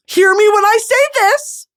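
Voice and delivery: high and loud, insisting; emphatic loud demand. Real, not acted. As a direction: make them listen, raise the voice. Insisting on the summer break.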